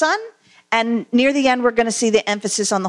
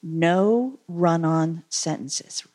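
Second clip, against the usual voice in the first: teacher's warning; 6 semitones lower, 4 dB quieter, much slower than usual. Retold or imitated. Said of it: "What did the teachers often say?".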